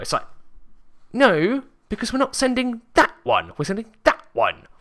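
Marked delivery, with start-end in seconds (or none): 1.1-4.7 high-pitched, loud retold protest; exasperated quote, pushed up high; retold exasperation. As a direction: insist in a raised, high voice. Retold baggage argument.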